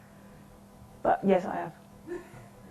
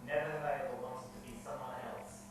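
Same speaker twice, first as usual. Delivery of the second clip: other player; 11 semitones lower, 25 dB quieter, much faster than usual. Pretend. Other player's turn voiced.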